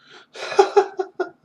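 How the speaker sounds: loud high-pitched laugh; sudden loud breathy cackle; real burst of laughter. Real, not acted. Finds his light melted.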